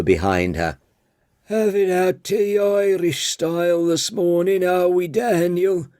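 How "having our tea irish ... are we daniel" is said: put-on high, creaky old woman teasing; old lady voice. Old tea lady teasing Daniel about whiskey, high old woman voice. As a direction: needle him like a nosy old tea lady. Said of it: "she said".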